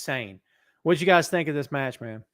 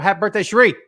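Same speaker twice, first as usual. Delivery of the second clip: higher, louder shout-out ending on the name; called-out birthday wish; 5 semitones higher, 9 dB louder, faster than usual. Real, not acted. Host wishing a viewer happy birthday, calling out. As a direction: call it out, building to the name.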